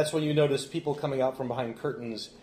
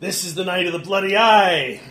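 loud, raised dramatic cry; exultant proclamation; 7 semitones higher, 10 dB louder than usual. Acted. Froman reveals the eclipse's meaning, exultant proclamation. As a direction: proclaim a dark omen in triumph.

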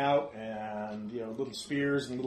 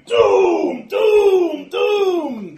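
creatures shouting; 14 semitones higher, 16 dB louder, much slower than usual. Acted. Dozens of little guys shouting at the party, ominous shouts. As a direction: voice a whole mob, switching pitch between long shouts. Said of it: "They're all shouting at you".

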